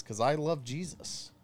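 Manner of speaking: usual speaking voice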